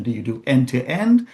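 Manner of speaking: ordinary talk, nothing marked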